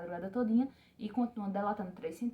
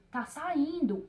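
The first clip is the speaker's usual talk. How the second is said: retold yell; 6 semitones higher, much slower than usual. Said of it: "quando elas gritavam".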